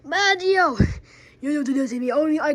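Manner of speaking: high, loud scolding of a name; angry shout. Acted. Toy yells at Mario for hitting him. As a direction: bark the name in outrage.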